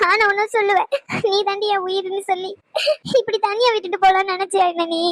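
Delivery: put-on a girlfriend weeping over his risk; tearful reproach. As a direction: sob out the accusation. Crying reproach to injured lover.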